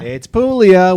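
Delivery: loud, high reveal of the name; emphatic intro. Acted. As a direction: present her with a flourish.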